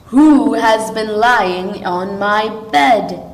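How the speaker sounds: loud, slow Father Bear boom. A narrator's reading. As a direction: boom it out slowly like a big bear. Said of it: "he asked in a very loud voice".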